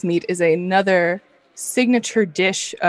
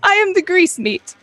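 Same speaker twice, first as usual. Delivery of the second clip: grease meat voice; 11 semitones higher, 4 dB louder than usual. Acted. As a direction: hail the hero like a pompous tiny meatloaf. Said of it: "and it says".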